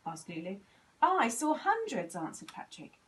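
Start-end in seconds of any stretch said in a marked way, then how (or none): 1.0-2.2 proud. A narrator's reading. Patrick proud.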